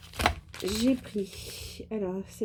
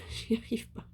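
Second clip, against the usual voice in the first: embarrassed laugh; 2 semitones higher, 5 dB quieter than usual. Real, not acted. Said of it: "je bafouille ce soir".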